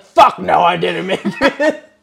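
loud, slow furious yell, half laughing; angry shout. Retold or imitated. The old man yells back at his caretaker in fury. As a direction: bellow it through the door. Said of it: "he was so mad".

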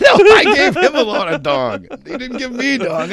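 correcting through laughter; laughing. Real, not acted. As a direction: laugh while setting it straight. Laughing while correcting friend.

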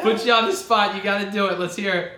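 loud laughing tease; laughing, egging on. Real, not acted. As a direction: laugh and push them to do it. Egging host to scream, laughing.

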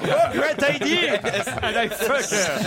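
put-on reprising the Englishman's accent through laughter; tossing off the punchline again, amused, in a mock English accent; laughing English accent. Host repeats the joke's catchphrase for the studio, laughing in a mock English accent. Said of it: "Il prend l'accent de Laurel et Hardy".

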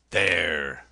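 a loud, spat-out "There"; loud defiant cry at the blockhouse; cried out. A narrator's reading. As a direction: fling the word at them. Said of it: "he cried".

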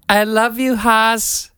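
put-on high, odd German accent. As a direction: say it like a weird German kid. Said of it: "in that weird German accent".

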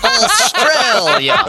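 high, very slow fake Australian accent; imitating a friend's overdone Australian accent; mock Australian accent. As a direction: stretch it out in a hammed-up Australian accent. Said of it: "he just went like this".

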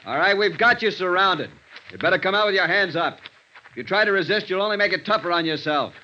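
loud, high call into the dark. Acted. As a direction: shout to someone hidden. Calling to a hidden fugitive.

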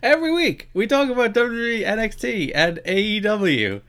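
high, loud hype intro; announcer intro. Acted. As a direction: sell it like a show open. Show segment intro.

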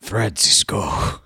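low, drawn-out villain croak; sick voice. Pretend. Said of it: "That's my sick voice right there".

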